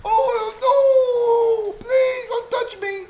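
very high panicked scream with laughter; scared shriek. Real, not acted.

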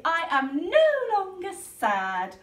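a touch of bright joy; happy Polly. Acted. Polly to her friends, lightly happy. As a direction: glad and thankful. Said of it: "said Polly".

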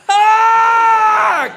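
sudden high yelp of pain; loud anguished "Ah!" as you hit the floor; pained cry. Retold or imitated. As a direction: yelp as your back gives out. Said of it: "I just let out a".

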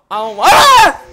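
sudden high shriek of fright; terrified scream. Real, not acted. Streamer hit by a jump scare, screaming in fright.

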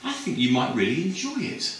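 high, hesitant and chatty; a lightly voiced girl fumbling for reasons; dreamy high sales pitch; gushing student. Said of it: "And her friend said".

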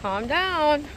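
slow, loud call to calm down. Real, not acted. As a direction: call him back firmly. Telling a kid to calm down.

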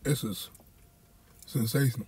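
chewing between words; savoring food while speaking; mouth partly full. Real, not acted. Seen 1: left man chews sandwich bite, eyes closed, then talks.

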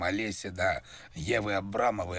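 ordinary delivery

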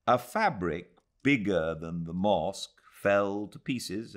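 ordinary delivery, nothing marked

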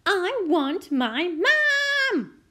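put-on loud, high, long-drawn child's howl. A narrator's reading. Princess refusing eggs and wanting mum, howling. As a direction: howl it, stretching the last word. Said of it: "howled the little princess".

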